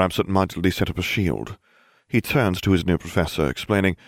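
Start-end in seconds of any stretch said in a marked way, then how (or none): none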